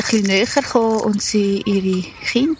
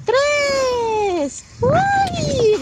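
countdown rising higher to a squeal; excited countdown; 12 semitones higher, much slower than usual. Real, not acted. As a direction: build it up playfully to the Ui. Counting down before slide.

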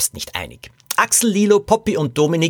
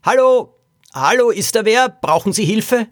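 high, raised calling voice; 9 semitones higher, 3 dB louder than usual. Acted. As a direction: call over the fence to see who's there. Calling over a fence for someone. (laut und hoch gerufen)

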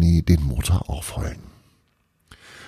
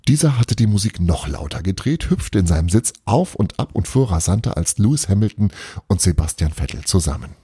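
ordinary delivery, nothing marked

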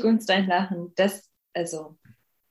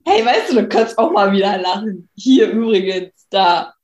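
loud; 6 dB louder than usual. Pretend. Addressing gloomy friend.

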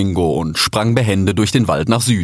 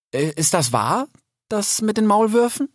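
hohe, zögernde Fragestimme; junger Rix; 12 semitones higher than usual. A narrator's reading. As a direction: deutlich höher, unsicher nachgefragt. (hohe, unsichere Frage)

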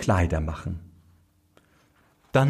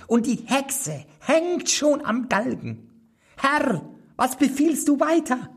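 much higher, small goblin voice. A narrator's reading. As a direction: pipe it up like a tiny magic servant. (hohe, kleine Koboldstimme)

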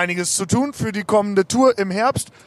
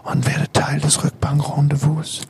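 whispering; 5 semitones lower than usual. Acted. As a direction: hush it close like ASMR. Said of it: "diese leicht erotische Stimmung".

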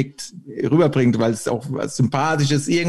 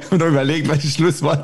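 laughing; 3 semitones higher than usual. Real, not acted. Laughing over closing words.

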